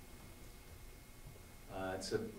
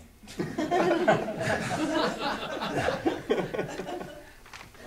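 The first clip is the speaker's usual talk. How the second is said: long nervous laugh; high nervous burst of laughter; 10 dB louder than usual. Real, not acted. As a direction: laugh off the nerves. Nervous laughter after his joke.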